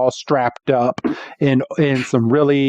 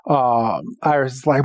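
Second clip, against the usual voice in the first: ordinary delivery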